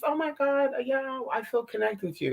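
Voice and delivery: high, soft mock gushing; gushing acquaintance. Imitating a too-nice person she met, high soft mock gush. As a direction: coo it with fake sweetness. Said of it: "you know, just".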